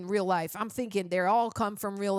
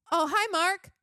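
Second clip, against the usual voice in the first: higher, abrupt switch to a greeting; mock actor greeting; 7 semitones higher than usual. Reviewer quoting the film's lead greeting a friend, mock cheery. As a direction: imitate a bad actor's sudden hello.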